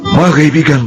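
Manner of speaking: ordinary talk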